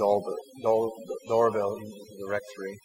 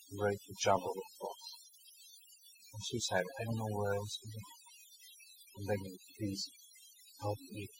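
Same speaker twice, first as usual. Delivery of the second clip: soft, much softer begging, quick at first; hushed pleading; 8 dB quieter than usual. Retold or imitated. Suicidal man begging the priest at his door, hushed and pleading. Suicidal man's plea retold.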